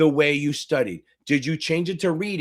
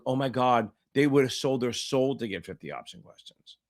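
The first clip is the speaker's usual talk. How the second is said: mock groaning; 4 semitones lower, 4 dB quieter than usual. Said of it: "like, groaning".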